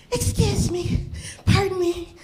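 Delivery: high, polite little voice; polite daughter. Retold or imitated. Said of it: "she's like that".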